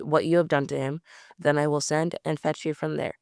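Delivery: usual speaking voice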